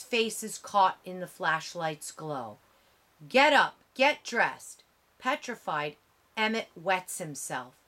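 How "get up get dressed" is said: a higher, louder barked command; harsh order. A narrator's reading. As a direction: roust the boy out of bed, harshly.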